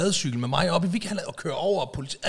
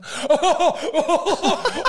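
loud, high burst of relieved laughter; high joyful laugh; 13 semitones higher, 5 dB louder than usual. Real, not acted. Overjoyed laugh. (høj, lettet latter)

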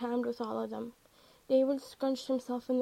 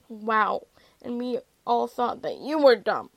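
mock-amazed, punched sarcasm; dry wow, then a loud jab; sarcastic; 7 dB louder than usual. A narrator's reading. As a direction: lay the sarcasm on with extra force. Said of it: "Billy replied sarcastically".

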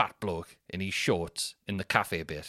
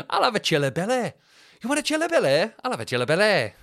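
loud, high, hammed-up Mancunian; Mancunian accent; 12 semitones higher, 6 dB louder than usual. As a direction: exaggerate a Manchester dessert order. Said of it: "that Mancunian accent is bang on".

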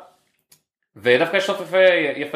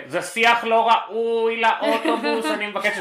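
put-on slow, scolding old lady voice; hammed-up prim old woman; old lady scolding the quarreling men; mock prim old lady; 9 semitones higher, much slower than usual.

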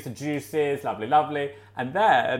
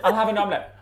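repeating, a bit higher; 7 semitones higher, much faster than usual. Retold or imitated. Guest repeating her order to an unseen cook, a bit higher. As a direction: say it again more clearly. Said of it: "this woman said".

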